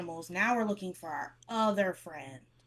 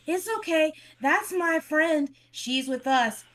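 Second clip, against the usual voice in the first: higher, louder, slow reassurance; reassuring character; 8 semitones higher, 8 dB louder, slower than usual. Acted. Character reassuring the ogre about her friend, raised and slow. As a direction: soothe the big ogre, pitched up. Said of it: "She would pat his hand again and be like".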